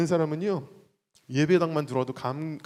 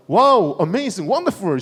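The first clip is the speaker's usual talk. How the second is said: much louder wow, amazing, wonderful; loud call; 3 semitones higher, 7 dB louder, much slower than usual. Shown as a demonstration. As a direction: shout it out for the crowd to echo. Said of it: "삼 절 갑니다".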